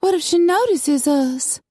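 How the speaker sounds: high, fluttery worried voice; anxious, pitched well up; fretting lady. A narrator's reading. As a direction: fret in a little high voice. Coralie frets about being seen.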